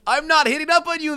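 high, heated retort; acted indignant denial. A narrator's reading. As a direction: deny it indignantly. Acted denial in golf quarrel.

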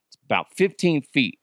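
ordinary talk, nothing marked

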